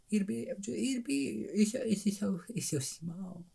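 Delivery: high, very soft little squeak; tiny voice. Acted. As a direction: shrink the voice to show something teeny. Miming how tiny the bowl is.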